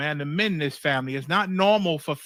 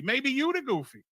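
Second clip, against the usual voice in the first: normal talking voice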